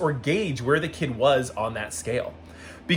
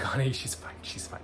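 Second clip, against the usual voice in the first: soft, hushed comforting; reassuring mom; 3 semitones lower, 11 dB quieter than usual. Retold or imitated. Mom reassuring the apologetic Grinch, soft and hushed. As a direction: hush it kindly, it's all okay.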